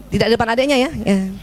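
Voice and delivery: ordinary talk, nothing marked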